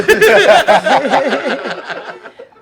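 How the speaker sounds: big burst of laughter; loud laugh. Real, not acted. Cracking up at the cheater joke, loud laugh.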